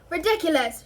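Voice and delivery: loud high shout. A narrator's reading. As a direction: shout the spell at the banshee. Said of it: "shouted Seamus".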